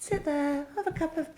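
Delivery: kindly hushed offer. Retold or imitated. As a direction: coax her gently to sit down. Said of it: "She was lovely".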